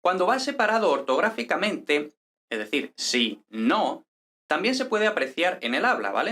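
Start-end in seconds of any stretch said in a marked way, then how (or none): 3.0-4.1 "si no" as two words, NO drawn out; stress on no. Shown as a demonstration. Teacher showing how separate "si no" sounds, stress on NO. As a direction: lean slowly on NO in "si no". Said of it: "si no lleva el acento prosódico en no".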